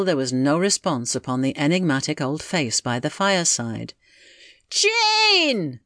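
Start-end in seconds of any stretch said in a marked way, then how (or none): 4.7-5.8 very high, drawn-out holler; calling out. Acted. Husband to deaf wife who ignores him, hollering. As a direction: holler the name insistently.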